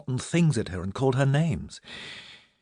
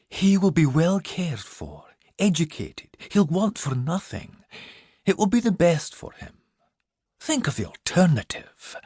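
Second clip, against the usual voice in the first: gentle persuasion; 7 semitones higher than usual. Acted. Minerva gently persuading Milly to give up the baby. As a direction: ease her into letting go.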